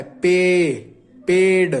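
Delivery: पे then पेड, with the ए drawn out and clear; stretched ए sound. Shown as a demonstration. Teacher showing students the ए sound in पेड. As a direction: split पे and पेड to show the ए. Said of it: "ये ए का sound कर रहा है".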